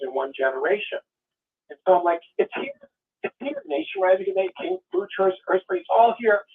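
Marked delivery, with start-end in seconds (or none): 2.4-6.4 high, breathless it's here; excited realization. Retold or imitated. A former believer reliving his panic, high excited. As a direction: squeal it out as the prophecy comes true.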